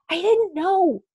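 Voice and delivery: whisper yell. Acted. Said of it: "she whisper yells".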